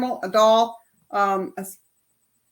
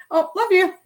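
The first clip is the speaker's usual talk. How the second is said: mock dismissive; 6 semitones higher, 5 dB louder than usual. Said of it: "I used to say".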